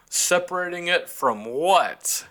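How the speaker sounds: slow, high, put-upon dad voice; grumpy papa bird. Retold or imitated. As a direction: drag it out like an annoyed father. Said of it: "Papa bird".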